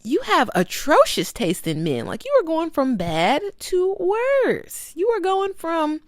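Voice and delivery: sassy, slow, high scolding of a friend. Retold or imitated. Talking to Pam like a girlfriend, sassy and high. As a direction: scold her like a bestie. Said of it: "But I'm like".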